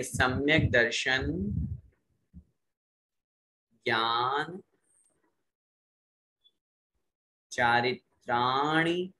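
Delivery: सम्यक दर्शन, ज्ञान, चारित्राणि in slow separate chunks; half by half. Shown as a demonstration. Teacher has class recite half at a time. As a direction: break the sutra into halves with pauses. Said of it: "आधा आधा करके बोलिए".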